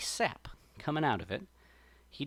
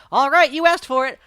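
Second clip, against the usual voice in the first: higher, louder cartoon rabbit warning; rabbit threat; 9 semitones higher, 11 dB louder than usual. A narrator's reading. Brer Rabbit warns the sap person before punching, loud. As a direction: wind up and announce the punch. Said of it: "And so he says".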